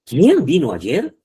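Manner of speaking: slow question, QUIÉN pitched high; question intonation. Shown as a demonstration. Teacher showing interrogative quién, questioning tone. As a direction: draw out "Quién vino ayer" as a direct question. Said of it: "está dentro de los signos de interrogación".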